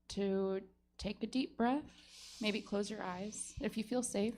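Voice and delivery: soft, soothing therapist calm, a little hammed-up; mock guided meditation. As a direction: lull the jury like a grounding exercise. Mock trauma-informed grounding exercise.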